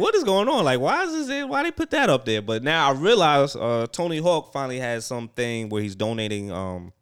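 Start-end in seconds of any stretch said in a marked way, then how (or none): 0.0-2.4 high-pitched, incredulous questions; retold bafflement. Reacting to an awkward online exchange, high-pitched bafflement. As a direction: voice shoots up in mock confusion.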